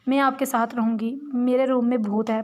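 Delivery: ordinary delivery, nothing marked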